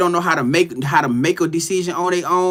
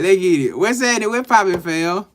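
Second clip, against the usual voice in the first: ordinary talk, nothing marked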